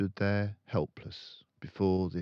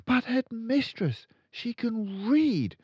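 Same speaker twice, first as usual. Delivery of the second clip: put-on high, polite young teacher; high pleading teacher; timid teacher; 8 dB louder than usual. A narrator's reading. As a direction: stand firm though nervous. Miss Honey pleads.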